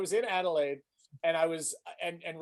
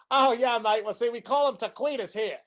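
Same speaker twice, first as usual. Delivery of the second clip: put-on Australian accent; 4 semitones higher than usual. Australian waiter explains his word, Australian accent. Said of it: "impressed by [name]'s Australian accent".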